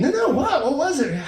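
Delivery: high, eager pressing questions; insistent curiosity. Acted. Partner pressing him about his dream, eagerly curious. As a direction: imitate a partner begging to be told.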